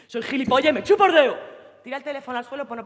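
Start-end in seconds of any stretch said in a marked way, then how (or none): 0.1-1.4 louder, higher, bitter; angry outburst. Acted. Girlfriend bitterly shouting at her cheating boyfriend, angry. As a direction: spit it out.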